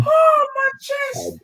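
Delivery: high, slow, wheezing from laughter; breathless laughing. Real, not acted. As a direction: gasp it out through the laughter.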